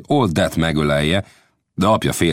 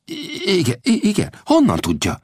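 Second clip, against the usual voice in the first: stammering astonishment, pitched up; stunned Will. Acted. Young Will stammers in surprise at Old Death. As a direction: caught off guard, stumbling over the words.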